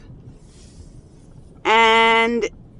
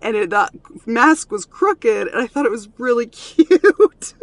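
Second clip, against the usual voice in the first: higher, louder, breaking into a laugh; amused, laughing; 5 semitones higher, 10 dB louder than usual. Retold or imitated. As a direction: let a fond giggle take over on the last word. Tells son the funny reason, laughing.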